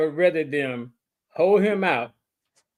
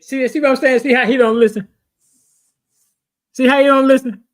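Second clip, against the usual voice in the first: loud, raised-pitch complaint; loud exasperation; 6 semitones higher, 9 dB louder, faster than usual. Real, not acted. Cohost complaining to the room that he won't listen, exasperated. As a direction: call it out loudly in exasperation.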